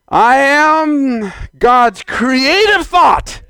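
high, very slow proclamation; emphatic declaration. Acted. Preacher claiming to be God's thought, slow ringing declaration.

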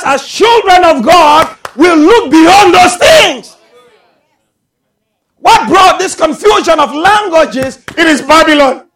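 loud, high, stretched-out sermon cries; full-force preaching. Real, not acted. Preacher calling believers to look beyond those things, full-force preaching. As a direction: draw the words out and proclaim them.

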